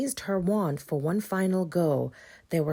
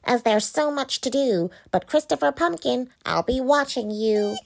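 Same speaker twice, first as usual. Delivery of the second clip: witch voice; 7 semitones higher, 4 dB louder than usual. Acted. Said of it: "snapped the witch".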